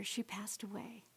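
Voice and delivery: whispered softly, voice falling away; hushed whisper. Real, not acted. Telling the audience her mother died, whispered. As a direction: let it drop to a real whisper. Mother's death after three weeks.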